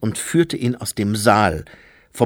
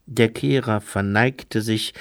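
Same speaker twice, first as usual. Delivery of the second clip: ordinary delivery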